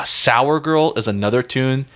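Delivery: normal talking voice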